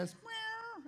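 high, soft cat meow. Retold or imitated. Pastor mimics the cat he heard in his kitchen, high meow. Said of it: "so I'm sitting there".

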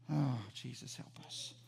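low, very soft appeal; hushed plea. Acted. Preacher calling on Jesus after his outburst, hushed plea. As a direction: let the voice drop away into a small prayer.

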